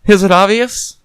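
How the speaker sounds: a lightly voiced flustered lady; nervous woman's voice. A narrator's reading. Woman apologizing to the detective, flustered.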